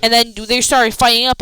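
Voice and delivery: normal talking voice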